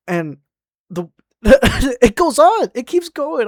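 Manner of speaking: high-pitched, cracking up in disbelief; laughing while talking. Real, not acted. Storyteller on coworker who keeps going, laughing in disbelief. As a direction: squeeze the words out through laughter.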